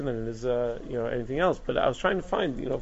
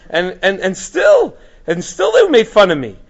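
much higher and louder, slowed protest; fervent quote; 7 semitones higher, 9 dB louder, slower than usual. Retold or imitated. Teacher voicing the rabbi defending his friends, high and loud. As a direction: insist they were good guys, voice soaring. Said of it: "So he says".

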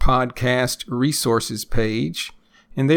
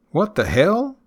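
"hell" swooping sharply up at the end; stress on hell; 8 semitones higher, 3 dB louder than usual. Shown as a demonstration. Teacher shows a shocked exclamation, rising stress on "hell". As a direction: dip on "the", then send "hell" high like a question. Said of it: "emphasis at the end on the word hell".

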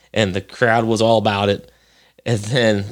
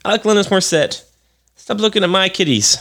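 a higher-pitched voice for the pet cat; lightly voiced cat; 8 semitones higher, faster than usual. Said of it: "She's like".

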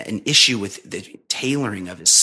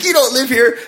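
high, accusing imitation of an angry wife; mock-yell it way up high; retold heated accusation; mock scream; 11 semitones higher, 4 dB louder than usual. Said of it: "starts screaming at".

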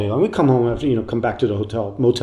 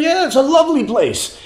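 lightly hammed Buddy on the phone; imitating [name]; 13 semitones higher than usual. Buddy phones the narrator at home, much higher. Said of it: "He's like".

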